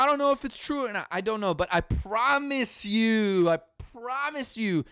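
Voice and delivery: ordinary talk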